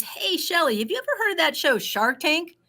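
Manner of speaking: friend's bright high pitch; eager friend. Retold or imitated. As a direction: sing it out like a keen friend. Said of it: "she reaches out to me on Facebook and says".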